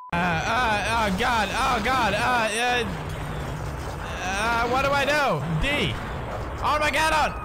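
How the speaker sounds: high-pitched, frantic dithering; panicked. Real, not acted. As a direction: stammer in panic, then blurt the answer. Rushed answer under time pressure.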